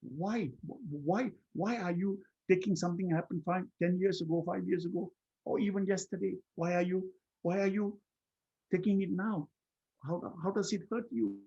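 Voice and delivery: high-pitched, insistent questioning; questioning oneself. Acted. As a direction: keep probing, repeating the why. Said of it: "By questioning myself".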